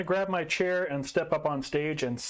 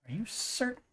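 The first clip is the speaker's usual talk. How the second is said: hushed worried question; 9 semitones higher, 6 dB quieter than usual. Acted.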